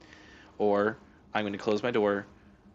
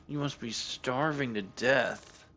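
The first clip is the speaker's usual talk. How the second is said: "You must be starving to death" drawn out slowly, sarcastic sympathy; slow mock pity; 3 semitones higher, slower than usual. Real, not acted. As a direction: tease the cat with fake concern.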